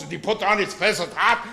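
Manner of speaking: ordinary talk, nothing marked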